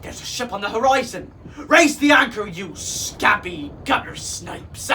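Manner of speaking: loud orders to a pirate crew; bellow, then stretch out the insult; hearty pirate bellow; bellowing captain. A narrator's reading. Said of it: "the captain bellowed to his crew".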